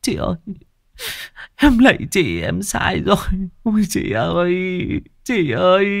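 high, weeping lament; sobbing. A narrator's reading. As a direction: sob to the dead sister. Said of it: "bật khóc thút thít". (giọng khóc thút thít)

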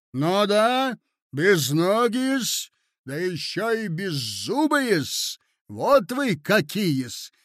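spiteful taunting. Acted. Lady listing the prince's defects to him, taunting. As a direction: rub in each insult, stretching it.

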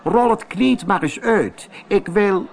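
friendly lady. Acted. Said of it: "zei een vriendelijke mevrouw".

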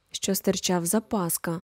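usual speaking voice

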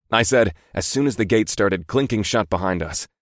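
ordinary delivery

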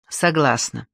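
plain reading voice

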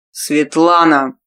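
slow, low growled name. A narrator's reading. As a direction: growl her name in anger. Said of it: "прорычал Варай".

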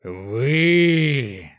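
long, loud roar on one word. A narrator's reading. Prince facing the mob, furious roar. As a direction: roar it out in fury. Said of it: "заревел принц".

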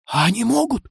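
high, wary query; nervous, rising disbelief; startled question. A narrator's reading. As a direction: ask with a jolt of worry. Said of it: "вздрогнул Горыша".

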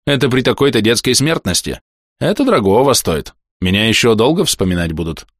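a hint of a blunt stern physician; stern doctor voice. Доктор хвалится больному. (лёгкий голос хмурого доктора)

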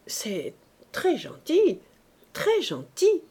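slow, admiring, rising on très; a touch of greedy delight; delighted murmur. A narrator's reading. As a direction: savour the place, pleased. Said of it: "murmura Olympe".

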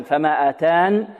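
آتان said slowly with the final ya dropped; pausal form. Shown as a demonstration. Teacher shows the pausal reading, slow and clear. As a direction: stop on آتان without the ya. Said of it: "وفي الوقف لو فيها وجهان".

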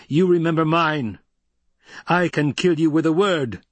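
high, forceful threat; menacing threat. A narrator's reading. Paul threatens the old woman.

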